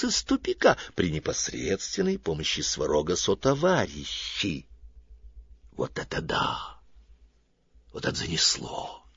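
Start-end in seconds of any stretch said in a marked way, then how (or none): 5.8-9.0 whispered amazement; astonished whisper. A narrator's reading. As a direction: breathe it out in disbelief. Narrator's astonished aside. (изумлённый шёпот)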